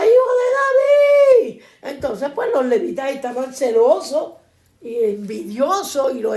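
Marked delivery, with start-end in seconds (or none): 0.0-1.6 loud, high drawn-out shout; long, full-voiced cry, pitched way up; crowd crying out. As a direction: call out like a pleading crowd. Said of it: "todo el mundo gritaba".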